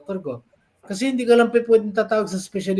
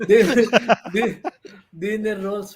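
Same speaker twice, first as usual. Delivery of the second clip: stumbling over the words through a laugh; half-laughing the correction out; 3 semitones higher, slower than usual. Real, not acted. Laughing as he corrects his friends about the rolls.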